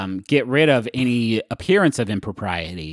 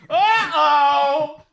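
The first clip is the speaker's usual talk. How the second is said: uh-oh. Pretend. Voice from cheesemonger back room.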